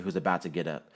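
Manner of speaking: plain reading voice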